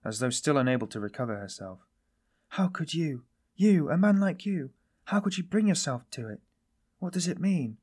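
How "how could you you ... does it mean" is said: higher-pitched, bewildered questioning; complete bewilderment. A narrator's reading. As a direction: ask in utter disbelief, pitch raised. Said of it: "she said in complete bewilderment".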